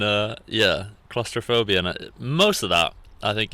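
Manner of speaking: normal talking voice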